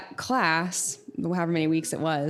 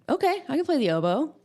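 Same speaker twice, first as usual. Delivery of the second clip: higher, lightly acted easy yes; game agreement; 8 semitones higher than usual. Student accepting the band director's suggestion, game agreement. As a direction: agree readily, pitched up.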